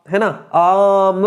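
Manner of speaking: आम with the आ drawn out slowly; long vowel demo. Shown as a demonstration. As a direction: stretch the आ in आम. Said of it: "इसमें ज़्यादा समय लग रहा है". (आम में आ को लंबा खींचकर)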